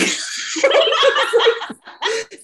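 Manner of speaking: high burst of laughter. Real, not acted. Laughing while retelling the curse, high laughter.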